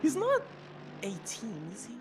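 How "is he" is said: ordinary talk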